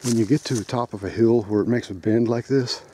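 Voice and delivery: ordinary talk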